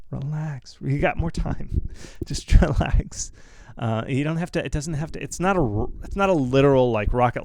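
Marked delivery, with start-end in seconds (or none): 0.1-3.3 calm the nervous viewer down gently; reassuring. Real, not acted. Calming anxious devs in Q&A.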